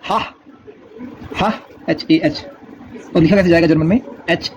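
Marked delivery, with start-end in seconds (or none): 0.0-0.2 हा said as open "hah"; German letter H. Shown as a demonstration. Teacher showing learners German H, हा as "hah". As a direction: say हा with a breath of H, long "ah". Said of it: "pronunciation में".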